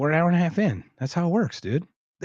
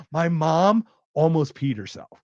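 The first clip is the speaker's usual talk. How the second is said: ordinary talk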